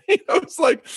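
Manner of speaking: amused, laughing while telling it. Real, not acted. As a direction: laugh through the words. Laughing at own retold story.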